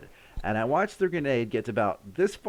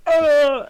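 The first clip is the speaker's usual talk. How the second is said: loud, very high scream; retold scream of fright; buddy's yell; 10 dB louder than usual. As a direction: scream as you dive for cover. Buddy yells while ducking.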